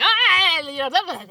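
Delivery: loud, high yell tumbling into gibberish; mock yell, high and very loud; mock outburst. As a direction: burst out with an imitated holler. Acts out grandfather's panicked outburst.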